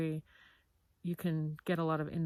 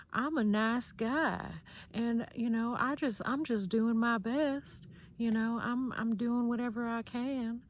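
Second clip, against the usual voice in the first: imitating a nice-guy Southern charmer; syrupy Southern accent laid on thick; charming Southern accent; 7 semitones higher than usual. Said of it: "he talks in a really charming accent".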